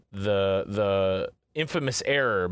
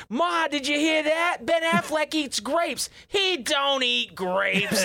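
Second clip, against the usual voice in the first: much higher hammed-up Boston accent, slowing down; Boston brother; slower than usual. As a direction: squeak out the starstruck Boston brother, drawn out. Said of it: "And the exchange is".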